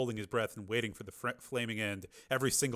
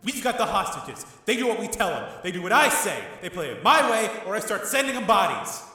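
loud, higher furious refusal; angry rant; 10 semitones higher, 8 dB louder than usual. A narrator's reading. Tommy raging at his brother over a deal, loud anger. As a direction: rage at him. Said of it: "turning on him angrily".

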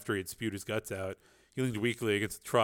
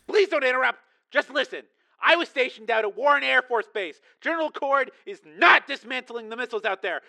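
much higher, pressing tense voice; urgent caller; 13 semitones higher, 5 dB louder than usual. A narrator's reading. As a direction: cut her off urgently. Tracy voiced on phone.

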